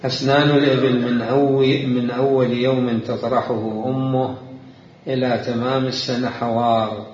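loud, measured classical Arabic recitation; formal reading. Acted. Teacher reads classical text to students, formal reading. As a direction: read the passage out slowly and firmly. Reads the book passage aloud.

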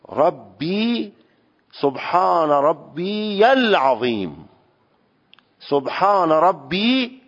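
ربی drawn out slowly, with the kasra stretched into یا; slow lengthened yā. Shown as a demonstration. Teacher modelling the lengthened reading of ربی. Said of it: "اینه که بگیم".

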